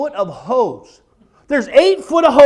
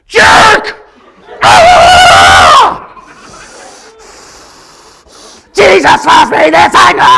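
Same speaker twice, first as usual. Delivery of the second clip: much higher, much louder furious shouting; road rage yell; 8 semitones higher, 10 dB louder, much slower than usual. Acted. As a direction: scream at the driver who cut you off. Road rage at other driver.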